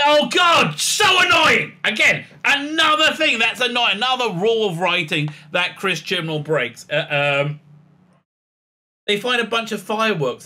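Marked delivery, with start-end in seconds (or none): 0.0-4.7 loud, high, fed-up yell; real annoyed shout, much louder; exasperated outburst. Real, not acted. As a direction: vent real frustration at the writing. Reviewer's own exasperation.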